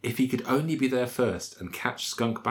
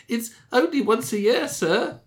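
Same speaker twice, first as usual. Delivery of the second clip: much higher, begging little clerk voice; pleading clerk; 14 semitones higher, 3 dB louder than usual. Acted. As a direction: plead for mercy in a tiny high voice. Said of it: "pleaded Skunk".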